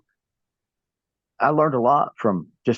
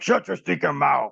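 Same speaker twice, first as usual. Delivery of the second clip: put-on higher, fuller Cuban heel accent on "Shut your stinking mouth"; Cuban heel catchphrase; 6 semitones higher than usual. Wrestler shows the catchphrase he took from Gorgeous George, Cuban accent. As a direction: give the catchphrase its heel sneer, in character. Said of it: "I'm like".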